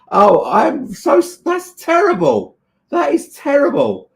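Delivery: high, slow and aghast at the news; stunned sympathy, voice jumping up; shocked dismay. Real, not acted. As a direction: gasp out how terrible it is. Reacting to lost parcel news.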